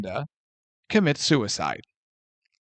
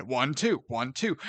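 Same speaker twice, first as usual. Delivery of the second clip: soft counting; 6 semitones lower, 10 dB quieter than usual. Shown as a demonstration. Demonstrating John Wick double tap.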